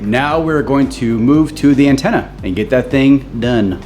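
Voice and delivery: ordinary talk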